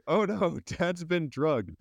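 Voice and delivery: high, slowed mock alarm as Lisa; high mock-panicked child voice. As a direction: lightly play the little girl's alarmed cry. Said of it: "Lisa going".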